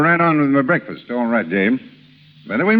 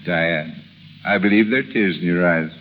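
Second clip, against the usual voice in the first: tender; 7 semitones lower, 4 dB quieter than usual. Acted. Husband touched by his wife's tears, tender and low. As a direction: lower and softer, gently moved.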